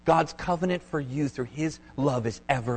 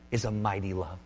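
ordinary delivery

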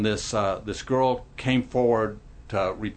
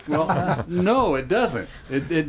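higher, slow answer through laughter; laughing reply; 7 semitones higher than usual. Real, not acted. Pastor answering after the skit's punchline, laughing. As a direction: answer while still amused, pitched up.

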